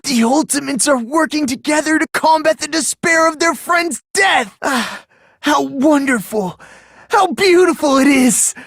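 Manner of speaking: high, swooning rapture; ecstatic rapture. Acted. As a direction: savour it like a revelation. Ecstatic about despair and hope.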